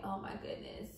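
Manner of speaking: breathy, worn-out whisper; hushed whisper. Retold or imitated. Pregnant wife recalls her side burning at night, whispered. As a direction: let it out under your breath in pain.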